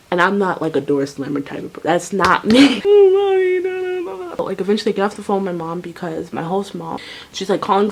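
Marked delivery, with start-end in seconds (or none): 2.9-4.5 high, long crying wail; mock wail. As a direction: wail like a frustrated sob. Imitates her crying on phone.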